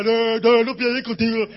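no rotundo, mucho más fuerte; negativa firme. Retold or imitated. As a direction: rechaza la pelea de golpe. Rocky rechaza la pelea. (Rocky negándose con fuerza)

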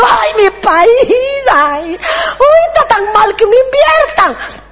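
slow, high, tearful pleading; hammed-up weeping ambassador begging; mock wailing. Acts ambassador begging for his country.

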